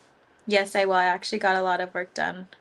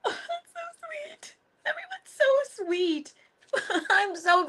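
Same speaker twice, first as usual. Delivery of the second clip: touched squeal; slower than usual. Real, not acted. Touched by viewers' kindness.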